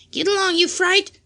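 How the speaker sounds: high, loud parrot scream. A narrator's reading. Parrot screaming rudely at Amy, high and loud. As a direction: screech it at the girl. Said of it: "screamed Polly".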